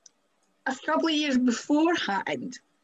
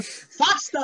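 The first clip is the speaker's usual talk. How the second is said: higher, punchy mock order; pitched-up retold urging; 5 semitones higher than usual. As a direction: imitate an insistent command to a driver. Imitating coach urging baffled driver.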